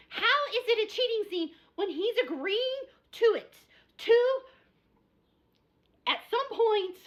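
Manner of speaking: high, loud, insistent arguing; worked-up rant. Real, not acted. Fired-up reviewer defends the book to viewers, high and insistent. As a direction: argue it's not cheating, voice climbing high. Ranting about spoiler scene.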